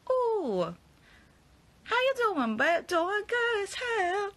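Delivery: high sassy singsong greeting, slowing on good as hell. Retold or imitated. Listening to [name] in the morning, high sassy voice. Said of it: "listen to it in the morning and be like".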